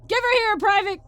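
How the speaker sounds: loud, high sergeant's order; commanding shout. A narrator's reading. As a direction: bark it at the private. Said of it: "commanded Senpai Sergeant".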